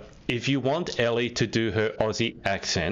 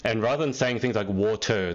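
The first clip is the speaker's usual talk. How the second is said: usual speaking voice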